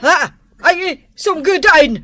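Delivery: loud, high alarmed cry at the flames; panicked cry. Acted. Wife sees the stove burning, panicked loud cry. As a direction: cry out in panic as fire starts.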